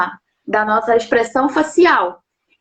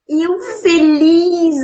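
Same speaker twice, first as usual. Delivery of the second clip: with joy; 5 semitones higher, much slower than usual. Shown as a demonstration. Showing the interviewer how joy carries the meaning, beaming. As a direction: stretch out "eu feliz" gladly, like real happiness. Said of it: "com aquela alegria".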